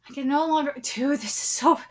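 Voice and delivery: usual speaking voice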